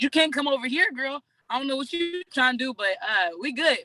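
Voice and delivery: high-pitched mock warning off; playful protest. Telling a coach to stay off her team, playful protest. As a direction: sassily shoo her away, half joking.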